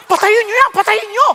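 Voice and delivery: high, loud mock panic shout; comic shout. Pastor shouting at the TV showing his own sermon, high, loud mock panic. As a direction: yell to kill the TV in comic horror. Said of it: "Sabi ko".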